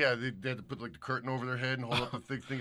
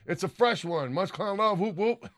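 shout-out read; 8 semitones higher, 5 dB louder than usual. Acted. Reads out a supporter's message.

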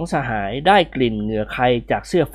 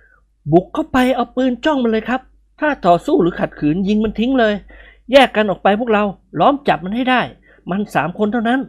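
higher, firm orders; character voice; 7 semitones higher than usual. A narrator's reading. Young soldier ordering the ambush, firm higher voice. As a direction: give the attack orders in character.

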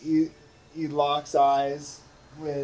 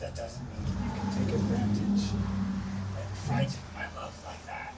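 quiet, menacing undertone; hushed vampire lord; 3 semitones lower, 4 dB quieter than usual. Acted. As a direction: threaten softly as a lord. DM voices Strahd.

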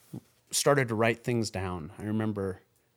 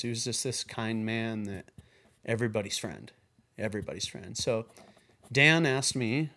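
ordinary talk

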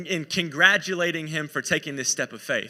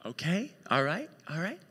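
softly rising, uncertain okays; acted wife's hesitant questioning agreement; doubtful acceptance; 4 dB quieter than usual. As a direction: go along with it, unconvinced. Acted wife taking in boat news.

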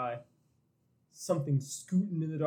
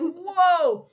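high, loud exclamation; hammed-up astonishment; amazed whoa; 13 semitones higher, 8 dB louder than usual. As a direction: cry out as if your mind is blown. Acting the reader's amazed reaction.

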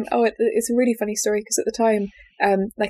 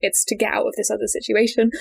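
ordinary talk, nothing marked

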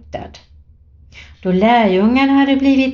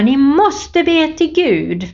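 high, slow urgent call to pray; mother calling out; 7 semitones higher, 3 dB louder, slower than usual. Retold or imitated. Mother in the flooding boat urging her children, raised urgent call. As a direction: call out to the family in the storm. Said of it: "Och då ropade mamma".

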